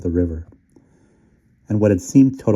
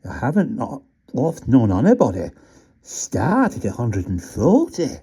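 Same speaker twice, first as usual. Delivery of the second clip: rat working out the sum; puzzled Yorkshire rat; 5 semitones higher than usual. A narrator's reading. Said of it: "the rat remarked".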